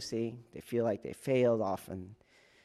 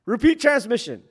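loud callout; 10 semitones higher, 8 dB louder than usual. Retold or imitated. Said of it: "they'll go like".

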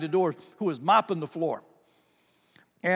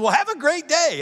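high, loud, peppy send-off; excited store worker; 9 semitones higher, 5 dB louder than usual. Retold or imitated. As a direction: imitate an overeager employee seeing off a customer. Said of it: "they were excited about what they were doing".

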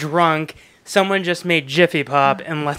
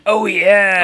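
Kool-Aid Man; 3 semitones higher, 3 dB louder than usual. Retold or imitated. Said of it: "like the Kool-Aid Man".

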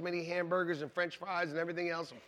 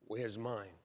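grudging sarcasm; 9 semitones lower, 11 dB quieter than usual. Retold or imitated. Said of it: "you're like".

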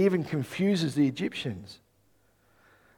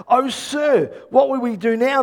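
higher-pitched, mildly dramatized cry for help; anxious cry; 6 semitones higher, 4 dB louder than usual. A narrator's reading. Servant to Elisha at the sight of troops, anxious cry. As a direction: read as a worried servant calling out. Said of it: "the young man cried to Elisha".

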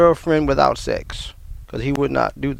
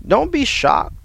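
exclaimed; 12 semitones higher, slower than usual. Real, not acted. Sudden exclamation to the congregation.

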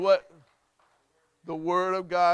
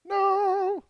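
high, held, louder choral note; soprano part; 5 dB louder than usual. Shown as a demonstration. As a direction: pitch it up like a soprano.

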